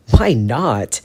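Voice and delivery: loud, demanding echo of the question. Acted. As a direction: throw the question back hard and loud. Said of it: "James demands".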